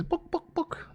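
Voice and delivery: high clucking "book, book, book"; chicken voice. Acted. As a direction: squawk it like a chicken asking. Chicken voice in joke.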